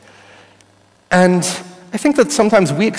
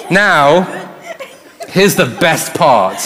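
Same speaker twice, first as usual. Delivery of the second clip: ordinary delivery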